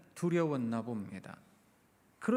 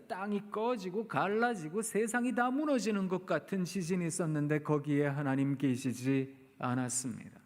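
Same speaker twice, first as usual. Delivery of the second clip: ordinary delivery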